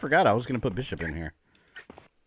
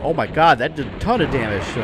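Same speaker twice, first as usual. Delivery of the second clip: excited; 5 semitones higher, 8 dB louder than usual. Real, not acted. Big hit in game.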